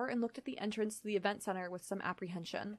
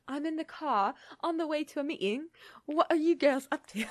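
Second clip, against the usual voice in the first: put-on light British accent, slightly higher; 6 semitones higher, 4 dB louder than usual. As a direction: read Henry's text in a British accent. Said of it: "if you wanna do a British accent".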